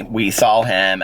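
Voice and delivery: ordinary talk, nothing marked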